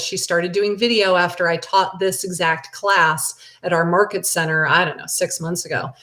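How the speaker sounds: ordinary talk, nothing marked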